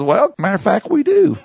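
Anna gushing to the neighbor about the dishwasher; delighted Anna. Retold or imitated. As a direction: gush about the new dishwasher. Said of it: "And Anna goes".

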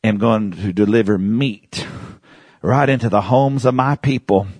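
a hint of a solemn God voice; voice of the Lord. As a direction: speak it as a grave divine promise. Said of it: "He goes".

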